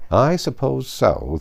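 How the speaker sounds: sulky. Acted. Tweedledee sulkily agrees to the battle, slow and grudging. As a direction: give in grudgingly, dragging it. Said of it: "sulkily replied".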